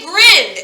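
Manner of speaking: "grinned" slow and loud, ending in a D; one-syllable pronunciation. Shown as a demonstration. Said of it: "the sound you actually hear is the D sound".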